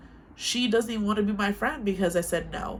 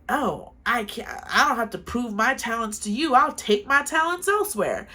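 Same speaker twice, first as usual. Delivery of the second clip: imitating a huffy friend, higher and rising into a loud snap; 5 semitones higher, 4 dB louder than usual. Friend storming off over a refused business deal, huffy and rising. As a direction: mimic her offended huffy flounce. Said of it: "she was getting all huffy about it".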